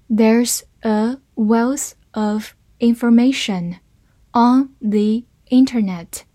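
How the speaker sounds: slow, careful model reading. Shown as a demonstration. Teacher reading slowly for the class to follow, slow and clear. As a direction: stretch each word out clearly. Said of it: "好，慢慢来读".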